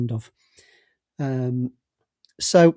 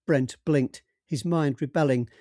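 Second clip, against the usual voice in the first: plain reading voice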